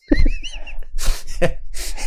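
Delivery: high, airless wheeze of laughter; breathless giggle; wheezing laugh. Real, not acted. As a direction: crack up silently at your own story. Laughs at own story.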